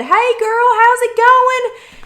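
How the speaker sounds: father-in-law greets her in the grocery store, booming and cheerful; cheery father-in-law greeting. Retold or imitated. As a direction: sing out a jolly hello to a relative. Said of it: "And he said".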